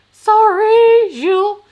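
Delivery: squealed high, loud sorry; a playful over-the-top apology; exaggerated apology. Real, not acted. Apologising to viewer for TMI.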